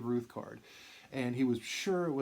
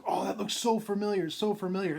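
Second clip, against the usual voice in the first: excited; 7 semitones higher, 4 dB louder than usual. Retold or imitated. Jim sees Mantle card picture.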